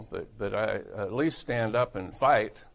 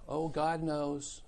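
softer, slow, higher mock-pious sigh of words; 5 semitones higher, 5 dB quieter, slower than usual. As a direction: mimic a resigned believer, slowly. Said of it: "this is stuff that baby Christians say".

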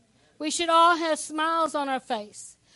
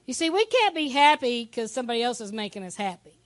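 ordinary talk

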